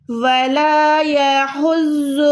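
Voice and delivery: slow chanted ویلا یحز with a guttural ha; Quran recitation. Shown as a demonstration. Tutor reciting the verse for her student: slow tajweed chant. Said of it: "ہا کو آپ نے حلق کے درمیان سے ادا کرنا ہے".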